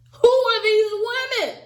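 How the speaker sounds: loud, high-pitched disbelief; incredulous outburst. Real, not acted. Reacting to Brett's third-date claim, incredulous exclamation.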